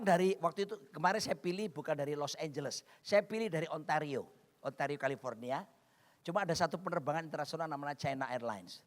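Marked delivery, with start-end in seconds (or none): none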